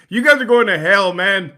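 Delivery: playful loud rebuke; loud mock condemnation. Real, not acted. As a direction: scold the chat, half laughing.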